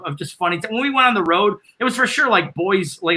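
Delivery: ordinary talk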